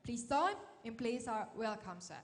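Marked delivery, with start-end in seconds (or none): none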